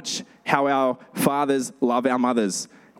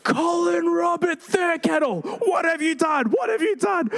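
much higher, furious shrieking shout at the husband; livid mum screaming. Retold or imitated. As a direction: scream his full name like a livid mum. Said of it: "my mum is livid".

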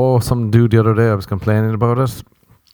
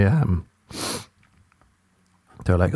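ordinary talk, nothing marked

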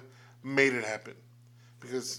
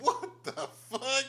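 laughing; 12 semitones higher, slower than usual. Real, not acted. Laughing disbelief at friend.

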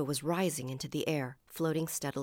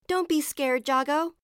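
high, loud shout of reassurance; 11 semitones higher, 9 dB louder than usual. A narrator's reading. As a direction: shout up to a frightened friend. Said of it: "Uma shouted".

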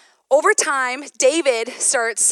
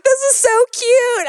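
high, loud gushing imitation of the dentist; delighted dentist; 12 semitones higher, 8 dB louder than usual. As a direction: gush like a charmed dentist. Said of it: "He's like".

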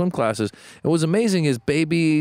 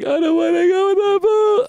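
put-on high, louder whining little boy; whiny kid voice; 5 dB louder than usual. Dad quoting his son refusing the pool, whiny. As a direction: whine it like a scared three-year-old. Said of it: "my kid's like".

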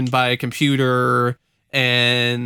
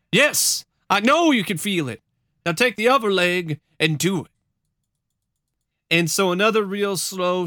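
higher-pitched dramatic storytelling; testimony read aloud; 7 semitones higher than usual. Acted. Witness recalls the old lady's first steps, dramatic retelling. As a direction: retell it with suspense, lifting the quotes. Reads testimony with acted quotes.

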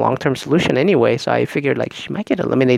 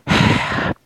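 long, heavy exhale; loud sigh. Pretend. Bachelor asked by the host how he feels, loud weary sigh. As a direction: let out a big weary sigh. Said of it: "sigh really loud".

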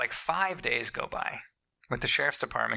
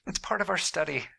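high, breezy mock scientist voice; lightly voiced alien observer; mock alien researchers; 6 semitones higher than usual. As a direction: play a casual alien tagging a specimen. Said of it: "They're, they're like".

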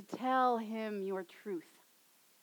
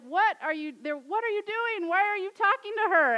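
higher, louder, rising indignation; indignant disciples; 7 semitones higher, 7 dB louder than usual. Retold or imitated. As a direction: demand an explanation, scandalized. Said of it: "he's like".

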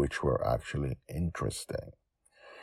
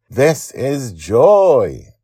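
high, slow, proud introduction; proud boy; 10 semitones higher, 13 dB louder, much slower than usual. Acted. Charlie introducing Joy to the party kids, proud and slow. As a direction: show off your best friend, drawn out. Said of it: "Charlie says proudly".

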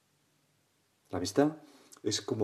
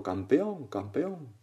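higher repeated call, fading off; imitated echo; 4 semitones higher, much slower than usual. Mountain echo returns the father's cheer, fading echo. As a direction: bounce the cheer back, dying away. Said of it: "Y el eco".

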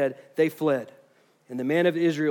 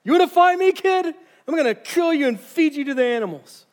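high, loud mocking jeer; taunting Goliath; 10 semitones higher, 4 dB louder than usual. Goliath taunts young David, loud and high with mockery. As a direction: sneer down at the boy. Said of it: "Now he's taunting David".

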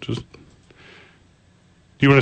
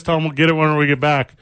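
ordinary talk, nothing marked

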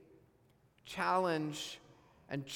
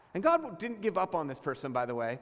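normal talking voice

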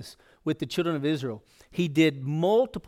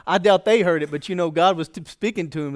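usual speaking voice

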